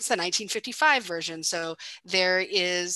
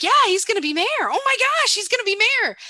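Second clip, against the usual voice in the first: much higher and louder, giddy; voicing Marty, thrilled at the future mayor; excited realization; 14 semitones higher, 6 dB louder, faster than usual. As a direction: blurt it out as the penny drops.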